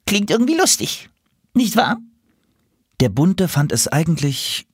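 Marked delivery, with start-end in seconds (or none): 0.0-2.0 high-pitched animal voice; reddish animal character. Acted. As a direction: pitch it well up, as a question. Reddish animal asks the parrot.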